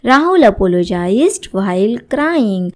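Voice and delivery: slow, clear classroom reading; slow model sentence. Shown as a demonstration. Teacher reading the example to students, slowly. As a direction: spell the sentence out for learners. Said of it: "teacher models English sentence".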